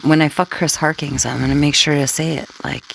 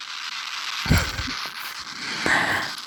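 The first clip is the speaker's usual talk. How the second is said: breathy soft laugh; hushed little laugh; whispered giggle; 10 dB quieter than usual. Real, not acted. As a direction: giggle under your breath. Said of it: "when I whisper".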